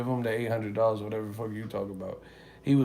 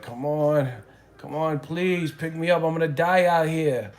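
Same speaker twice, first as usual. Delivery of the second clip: higher, louder, hammed-up begging; weird desperate plea; 6 semitones higher, 6 dB louder than usual. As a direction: imitate a panicked guy on the phone. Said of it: "talking hella weird".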